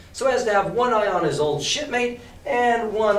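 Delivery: ordinary delivery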